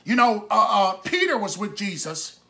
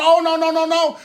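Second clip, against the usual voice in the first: raised, louder string of refusals; Peter's emphatic protest; 6 semitones higher, 6 dB louder than usual. Acted. Peter insisting to Jesus he would never deny him, emphatic protest. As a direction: protest loudly, pitched up, cutting off the very idea.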